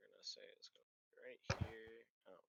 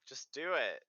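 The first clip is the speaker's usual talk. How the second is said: raised, loud exasperated prod; impatient urging; 5 semitones higher, 11 dB louder than usual. Real, not acted. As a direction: push a dithering player to act. Game master urging hesitant player on.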